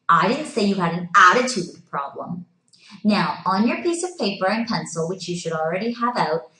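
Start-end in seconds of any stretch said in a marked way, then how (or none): 0.1-2.5 "attitude" punched loud; emphatic "attitude" near the end; stress on ATTITUDE. Shown as a demonstration. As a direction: put all the weight on "attitude". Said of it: "emphasizing a different word".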